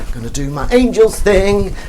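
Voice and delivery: imitating a cocky friend sure of his big number. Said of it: "like, all cocky".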